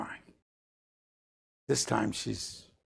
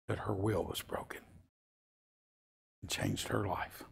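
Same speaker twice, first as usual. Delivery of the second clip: softer near-whisper; hushed and solemn; 3 semitones lower, 8 dB quieter than usual. Real, not acted. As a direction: let it sink in quietly. Solemn climax of story.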